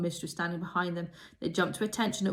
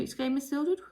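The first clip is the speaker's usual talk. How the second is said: ordinary delivery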